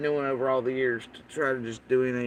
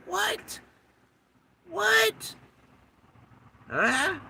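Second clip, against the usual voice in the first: incredulous; much slower than usual. Real, not acted. Incredulous squeak after the quote.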